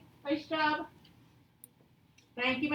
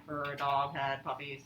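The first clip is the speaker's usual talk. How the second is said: much lower, slower, robotic monotone; 11 semitones lower, 5 dB quieter than usual. Shown as a demonstration. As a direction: read it like a machine. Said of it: "like a robot".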